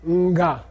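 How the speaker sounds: NGA with an audible little N before the G; prenasal. Shown as a demonstration. Teacher has class hear the N, prenasal Nga. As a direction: let a short nasal hum open "Nga". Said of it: "Hear that little N sound?".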